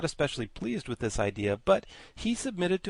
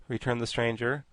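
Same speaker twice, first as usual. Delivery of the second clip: ordinary delivery, nothing marked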